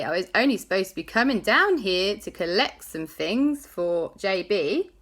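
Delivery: usual speaking voice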